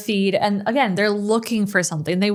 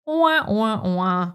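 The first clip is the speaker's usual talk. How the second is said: sad trombone wah wah; much slower than usual. Acted. Visitor lands on a messy profile page: mock sad trombone. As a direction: sing a deflating sad trombone.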